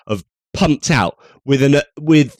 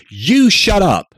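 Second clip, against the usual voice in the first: mock retort; 7 semitones higher, 3 dB louder, slower than usual. Mocking retort at official.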